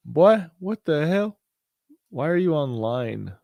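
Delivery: high, drawn-out disbelief; startled by a strange screen, slow and high; baffled outburst. Real, not acted. Confused by chart glitch.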